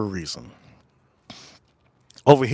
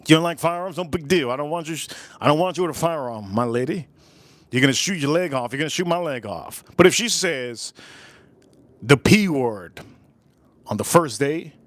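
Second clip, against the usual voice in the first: normal talking voice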